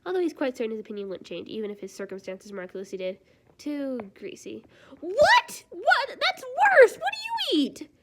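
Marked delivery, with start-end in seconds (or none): 3.6-4.6 slow disdainful whisper. Acted. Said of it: "character whispers disdain". 5.0-7.9 very high, loud shocked squeal. Acted. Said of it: "gasps like he's been shot".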